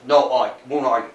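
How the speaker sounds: ordinary talk, nothing marked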